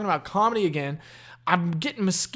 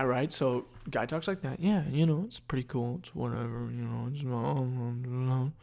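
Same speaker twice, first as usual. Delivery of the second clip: normal talking voice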